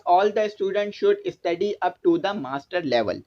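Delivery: slow, deliberate reading of the instruction; slow textbook reading. Acted. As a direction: spell out each English word for the class. Reads English topic aloud slowly. (कक्षा के लिए किताब से धीरे-धीरे पढ़ना)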